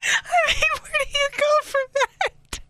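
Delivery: high, wheezing laughter in words; crying with laughter. Real, not acted. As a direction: squeak it out through laughter.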